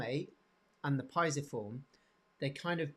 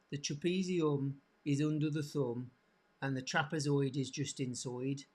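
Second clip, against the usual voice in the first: put-on Birmingham accent for a mnemonic; Brummie accent. Shown as a demonstration. Teacher giving students a memory rhyme, in Brummie accent. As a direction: recite it in broad Brummie vowels. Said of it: "do it in a Brummie accent".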